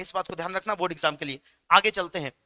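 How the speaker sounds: ordinary talk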